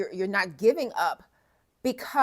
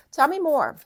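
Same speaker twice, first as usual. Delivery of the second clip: excited; 7 semitones higher, 7 dB louder than usual. Real, not acted. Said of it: "excited tarot reader hook".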